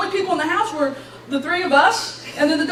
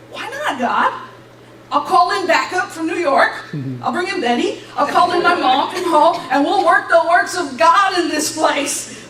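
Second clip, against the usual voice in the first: higher, louder plea, building in zeal; eager bargaining; 4 semitones higher, 4 dB louder than usual. Retold or imitated. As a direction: argue your case to God with comic zeal. Retold eager arguing with God.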